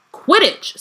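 sharp, indignant high outburst; angry. A narrator's reading. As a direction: spit out the word in outrage. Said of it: "said Hermione angrily".

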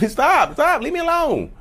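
very high-pitched mock squeal; changed, playful voice. Smiling partner joking with you now, high switched-up voice. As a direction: flip into a squeaky joking protest. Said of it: "now their voice has changed".

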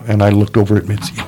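ordinary talk

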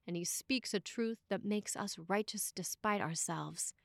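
ordinary talk

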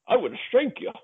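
high, cute snowman making a threat; a sweet snowman voice that threatens menacingly; Frosty the Snowman voice. Retold or imitated. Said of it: "the whole fight in the Frosty Snowman voice".

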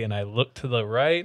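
ordinary delivery

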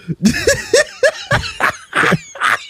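high squealing laughter; shrieking laugh. Real, not acted. Laughing at the drawing.

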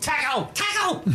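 high, excited tackle!; shouted command. Acted. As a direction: yell tackle! at your Pokemon. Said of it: "Okay, no, tackle".

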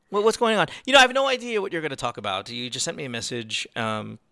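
ordinary talk, nothing marked

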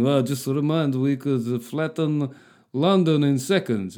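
slow, put-on voice for Putin; measured villainous reminder, drawn out; mock Putin threat. Putin warning the West about nukes, slow mock threat.

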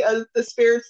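normal talking voice

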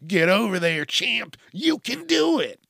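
high, loud pep-talk dad; cheery dad. Acted. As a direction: cheer them on like a gung-ho dad. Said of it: "one of those cheery dads".

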